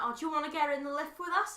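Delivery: light imitation of an Irish try at a Welsh accent, quick and bright; a hint of a put-on Welsh lilt; a quick, cheery offer in a faked Welsh accent; mock Welsh accent. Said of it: "in their best Welsh accent".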